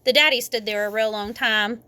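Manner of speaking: ordinary delivery, nothing marked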